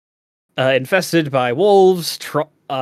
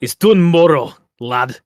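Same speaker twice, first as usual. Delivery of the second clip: put-on hearty dwarf accent, louder, rolling out Dun Moro; a proud dwarf's burr on it's Dun Moro, lad; dwarvy accent; 5 dB louder than usual. Showing cohost the proper dwarf way, in a dwarvish accent. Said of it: "You have to say it with a proper dwarvy accent".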